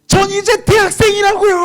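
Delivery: very high, loud yell; full-voiced reenacted protest; frightened defiant shout. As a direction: scream it in comic panic. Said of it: "아버지가 너무 무서워서".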